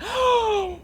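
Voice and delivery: sharp high gasp of amazement. Acted. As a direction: gasp like a whole astonished town. The town gasps.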